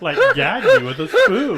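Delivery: put-on high, loud, laughing valley girl squeal. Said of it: "S1 valley girl bit".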